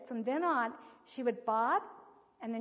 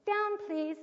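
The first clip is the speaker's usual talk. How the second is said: put-on high, sing-song cue to a toddler; high-pitched parent prompting a baby; modelled parent's prompt; 8 semitones higher than usual. Shown as a demonstration. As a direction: pitch it up, clear and even. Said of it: "you're gonna say".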